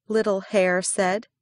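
ordinary delivery, nothing marked

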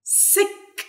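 "Sick" with short vowel and separately released k; model pronunciation. Shown as a demonstration. Teacher giving an example of C K, careful final k. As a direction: finish "Sick" on a soft, crisp k. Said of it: "زي كلمة".

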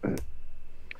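harsh cough to clear the throat. Real, not acted. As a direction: clear the throat after choking. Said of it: "Boğazıma kaçtı ya".

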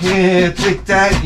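hammed-up spiteful jeer; mock gloating. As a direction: drag it out like a gleeful bully. Kicking dirt in his face, acted.

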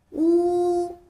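乌 held long and high, lips rounded; 乌 drawn out level for kids to copy; model pronunciation. Shown as a demonstration. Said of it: "再跟我读一遍吧".

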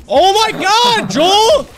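high, drawn-out cry of disbelief; excited shout. Real, not acted. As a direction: yell his name in shock.

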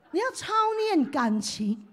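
ordinary talk

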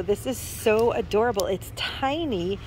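ordinary talk

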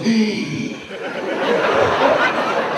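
long voiceless strained gasp; mimed stifled scream. Acted. Passenger forbidden to scream in the plane, stifled gasp. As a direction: imitate swallowing a scream in terror. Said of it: "el gringo hizo".

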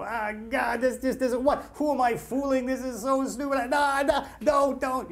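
high-pitched, exasperated muttering to self; hammed-up writer's frustration; acted-out self-doubt. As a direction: despair at your own writing. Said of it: "when you have a bad writing day and you go".

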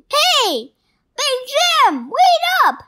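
high loud call; calling after. Acted. Kitten calling after Big Jim, high loud call. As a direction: shout after someone walking off.

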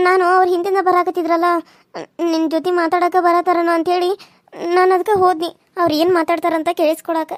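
ordinary talk

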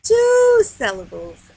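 TWO held long, high and loud; emphatic answer. Shown as a demonstration. Teacher emphatically giving the answer.

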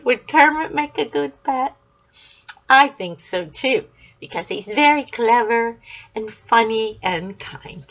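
normal talking voice

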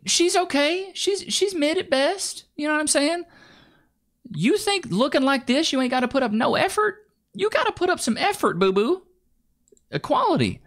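high, sassy put-down; high, mocking sass; sassy mockery. Real, not acted. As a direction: tease her condescendingly. Mocking the woman in clip.

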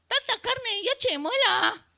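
piropo burlón. Retold or imitated. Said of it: "Y les gritaban".

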